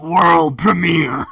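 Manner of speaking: slow, high drawn-out World premiere; mock announcer. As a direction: ham up the trailer voice. Mimicking announcer.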